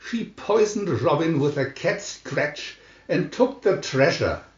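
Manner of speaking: ordinary delivery, nothing marked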